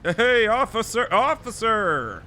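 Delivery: loud call to an officer. Real, not acted. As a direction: shout to get the cop's attention. Calling to police through glass.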